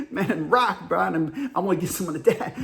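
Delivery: garbled country dude, barely understandable; comic impression of unintelligible speech; Sammy. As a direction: run words together with chuckling noises. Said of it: "when Sammy came up and he's like".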